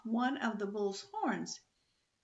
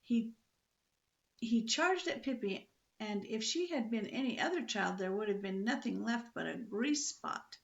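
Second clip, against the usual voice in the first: plain reading voice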